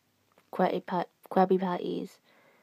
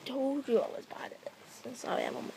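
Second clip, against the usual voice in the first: higher self-mocking whisper; 4 semitones higher, 10 dB quieter, faster than usual. Real, not acted. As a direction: confess it in a whisper.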